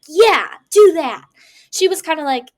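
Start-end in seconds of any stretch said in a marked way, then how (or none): 0.1-1.2 loud, high, slow and eager yes; excited yes. Shown as a demonstration. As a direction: sell it like a thrilled yes. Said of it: "like, excited yes".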